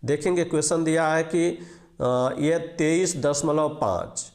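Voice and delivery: normal talking voice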